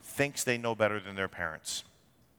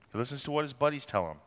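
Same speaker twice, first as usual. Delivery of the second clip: ordinary delivery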